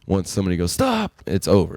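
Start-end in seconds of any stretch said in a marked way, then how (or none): 0.7-1.2 high yelp of stop; calling stop. Retold or imitated. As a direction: yelp it to end the fight.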